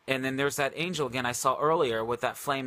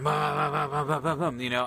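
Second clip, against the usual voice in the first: rumbling run of booms; mimicked banging sound effect; imitated shaking doors. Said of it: "doors just start shaking".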